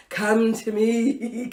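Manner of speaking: slow, drawn-out seductive call; sultry come-on. Retold or imitated. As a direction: lure him in, very slowly. Sultry daydream of summoning him.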